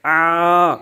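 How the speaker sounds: stretched aa held one alif; a prolonged Quranic aa; long drawn aa. Shown as a demonstration. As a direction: hold the aa sound out. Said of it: "اس کو دراز کریں گے".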